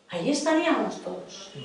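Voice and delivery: usual speaking voice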